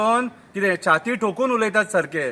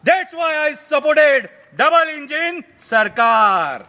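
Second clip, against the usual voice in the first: slow, higher, word-by-word English; old politician's declamation; 8 semitones higher, 4 dB louder, much slower than usual. Acted. Old politician confesses his last election, slow declamation. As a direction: proclaim it very slowly, stretching each phrase.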